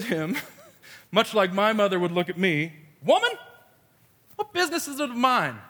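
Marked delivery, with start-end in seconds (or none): 3.0-5.6 high-pitched playful indignation; kidding backtalk. Retold or imitated. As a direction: sass your mom in a raised pitch. Replays Jesus' kidding retort.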